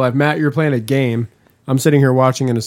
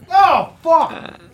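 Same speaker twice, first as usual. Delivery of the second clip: sudden high-pitched cry of revulsion; disgusted; 4 dB louder than usual. Real, not acted. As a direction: recoil from a foul smell with a yelp. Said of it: "That's disgusting".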